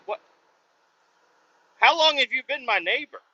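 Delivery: high, incredulous question; exasperated disbelief. Real, not acted. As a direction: ask it in baffled disbelief. Exasperated rant about neighbor.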